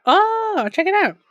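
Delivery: oh, check it out, voice jumping up high and loud; excited discovery. Real, not acted. Streamer spotting the audiobook, high excited exclaim. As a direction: perk up, thrilled at what you just found.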